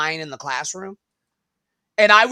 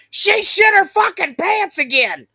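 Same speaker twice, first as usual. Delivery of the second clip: much higher, much louder shouted announcement; student announcing to the class, high loud shout; mock classroom shout; 14 semitones higher, 11 dB louder than usual. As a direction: yell it out to the whole class. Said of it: "stand up ... and be like".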